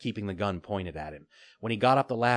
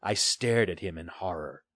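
ordinary delivery, nothing marked

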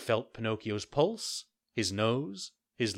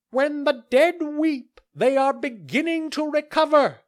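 solemn crow; 10 dB louder than usual. Acted. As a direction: declare it like a pompous bird doctor. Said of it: "said the crow solemnly".

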